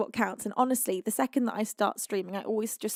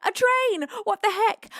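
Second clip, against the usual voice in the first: mock quote; 9 semitones higher, 6 dB louder than usual. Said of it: "You'd said".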